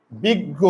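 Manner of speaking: বিজ্ঞ said as বিগ্গ with a double g; pronounced biggo. Shown as a demonstration. Teacher showing class বিজ্ঞ as বিগ্গ. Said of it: "খুব ভালো করে খেয়াল করো তো".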